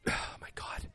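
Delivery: breathless exasperated whisper. Real, not acted. Reviewer stunned by the movie's dumbness, real whisper. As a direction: whisper it in disbelief.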